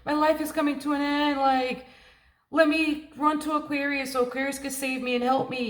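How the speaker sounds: high-pitched helpless wail, put on; hammed-up, high-voiced despair; mock overwhelmed panic. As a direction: imitate someone helpless begging to be rescued. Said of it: "so worked up and overwhelmed".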